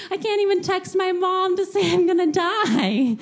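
high, loud, sing-song mock dread; melodramatic doom. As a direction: wail it like a tragic joke. Melodramatic fear of dying.

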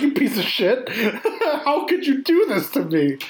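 high, laughing, playfully betrayed; mock outrage. Moviegoer scolding the film for making them cry, high mock outrage. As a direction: hurl it like a betrayed fan, laughing. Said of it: "And you're like".

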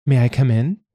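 high polite question, lifted at the end; high nasal request; visiting social worker. A narrator's reading. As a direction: ask in a high-pitched visitor's voice. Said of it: "Laura asked".

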